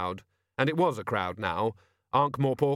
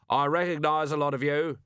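higher-pitched address to a crowd; raised voice; 4 semitones higher than usual. A narrator's reading. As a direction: project it over the crowd's heads. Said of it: "He stood back and raised his voice".

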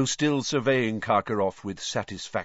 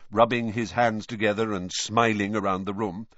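ordinary delivery, nothing marked

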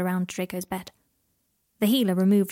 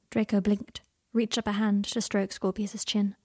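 ordinary delivery

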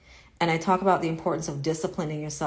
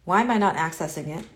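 ordinary talk, nothing marked

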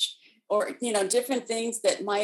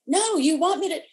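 high, loud retold child's objection; escalating child; 8 semitones higher, 5 dB louder than usual. Four-year-old not being listened to, high and insistent. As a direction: imitate a little girl protesting, high and louder. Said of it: "her voice was getting louder and louder".

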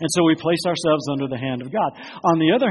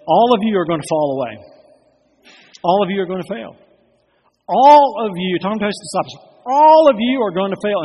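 loud, stern, rising warning; 4 semitones higher, 7 dB louder than usual. Acted. Jesus warning his disciples, loud and stern. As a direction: hammer the word all at the disciples.

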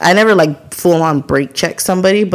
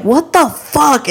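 high, drawn-out exasperation; mock outraged driver; retold disbelief; 10 semitones higher, much slower than usual. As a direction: stretch it out in baffled annoyance. Acted reaction to slow driver.

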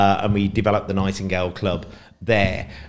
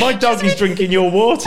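laughing; 10 semitones higher, 7 dB louder than usual. Real, not acted. Dog drinks guest's water.

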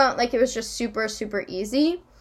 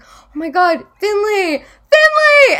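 excited shout building to a high yell; recognizing a friend from afar, louder each time; retold calling out; 9 semitones higher, 7 dB louder, slower than usual. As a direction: call her name, rising to a full high holler.

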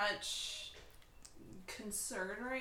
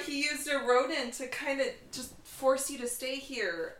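ordinary delivery

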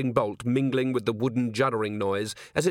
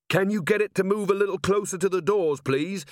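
high-pitched call from above; polite request called down from the rafters; 8 semitones higher than usual. A narrator's reading. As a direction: lift the voice to carry down. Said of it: "a voice from the darkness on high".

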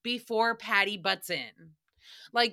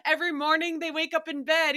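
ordinary delivery

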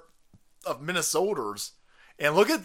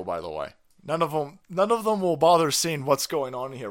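usual speaking voice

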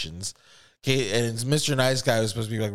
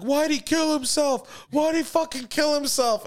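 mock hysterical crying. Freaked-out bandmate to his host, hammed-up hysterical crying. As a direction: imitate a stoned friend wailing. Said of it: "he starts hysterically crying".

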